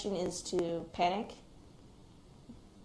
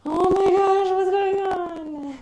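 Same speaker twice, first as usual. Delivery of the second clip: much higher, much louder frantic cry, slow on the last words; frantic mom; 12 semitones higher, 13 dB louder, much slower than usual. Retold or imitated. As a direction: imitate a mother bursting in panicked. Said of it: "She's going to my dad's room going".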